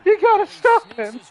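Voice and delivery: high, loud pleading coach voice; imitating a coach at the end of his rope; exasperated coach. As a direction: yell it like a desperate sideline coach. Voicing an exasperated imagined coach.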